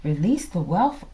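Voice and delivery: ordinary delivery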